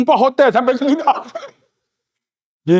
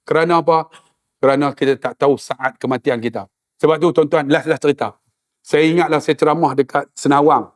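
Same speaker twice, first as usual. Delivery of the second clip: earnest sermon; 6 semitones lower than usual. Acted. Serious closing sermon.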